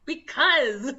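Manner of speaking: loud, rising "Because"; snappy retort. Retold or imitated. Clerk snapping back loudly at the customer. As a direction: fire it back like a fed-up clerk.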